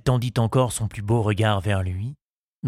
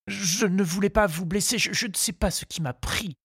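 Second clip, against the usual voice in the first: high suitor's voice, stumbling over words; flustered apologetic suitor; 10 semitones higher than usual. A narrator's reading. Charles excuses himself to Henriette, flustered.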